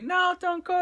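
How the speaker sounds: very high, small pleading voice; imitated little girl. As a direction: mimic her plea in a high voice, without shouting. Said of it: "and she's like".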